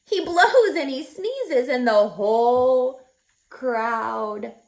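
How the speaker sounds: plain reading voice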